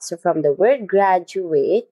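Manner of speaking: usual speaking voice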